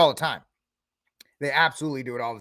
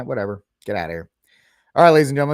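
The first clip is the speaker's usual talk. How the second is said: ordinary delivery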